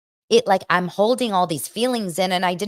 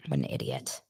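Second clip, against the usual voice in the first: muttered aside; 9 dB quieter than usual. Real, not acted. Mutters insult at commenter.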